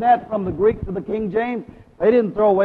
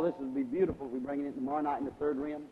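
ordinary talk

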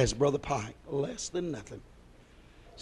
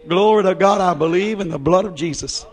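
ordinary delivery

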